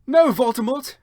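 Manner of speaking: high, loud mock shout. Heroine shouting back at Voldemort, loud and high. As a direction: yell it at the villain. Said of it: "I shouted back".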